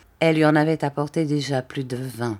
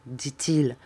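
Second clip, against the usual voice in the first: ordinary delivery, nothing marked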